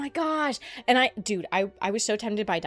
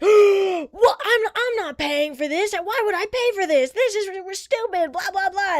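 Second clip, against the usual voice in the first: higher, louder whiny caller protest; mock indignant caller; 9 semitones higher, 5 dB louder than usual. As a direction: play the outraged customer refusing to pay.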